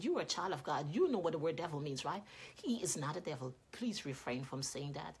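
soft, low, gentle reproof; discreet correction. Retold or imitated. Mother takes the woman aside about her son, quiet correction. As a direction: correct someone quietly and privately.

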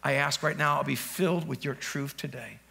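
hushed demo prayer to the Lord; quiet prayer. Shown as a demonstration. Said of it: "So this, say".

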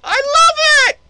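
loud, high victory whoop; excited laughing cheer. Real, not acted. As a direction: celebrate the win loudly. Boss beaten, cheering.

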